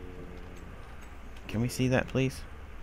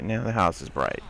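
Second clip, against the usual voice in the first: ordinary talk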